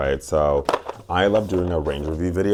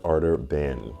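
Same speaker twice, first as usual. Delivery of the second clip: usual speaking voice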